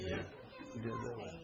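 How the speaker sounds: soft, slow, laughing half-whisper; breathy laughing repeat. Real, not acted. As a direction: say it again under your breath while still chuckling. Repeated softly through laughter.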